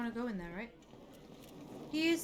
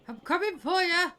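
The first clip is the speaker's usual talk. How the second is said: put-on Scottish accent drifting into Irish; attempted Scottish accent; 8 semitones higher, 9 dB louder than usual. Gamer playing at accents, wobbly Scottish turning Irish. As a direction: have a rough go at Scottish, sliding towards Irish. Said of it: "whenever I try to do Scottish, it always seems to morph into Irish".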